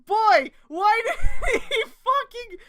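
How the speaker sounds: laughing incredulous outburst; laughing rant. Real, not acted. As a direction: cackle out the complaint in disbelief.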